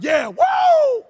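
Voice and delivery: high, loud whoa of triumph; ecstatic cheer. Retold or imitated. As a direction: cheer like you just saw the sea split. Moses and Aaron celebrating.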